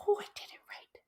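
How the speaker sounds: gasp, then whisper in real surprise; hushed, breath-caught amazement; amazed whisper. Real, not acted. Speaker surprised by her first correct try, amazed whisper. First success at the hard word.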